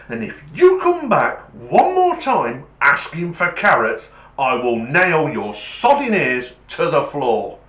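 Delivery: loud, hammed-up furious threat; acted butcher rage, slowing to drive it home; losing his rag. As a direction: build the threat, hammering each word. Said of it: "He's lost his rag".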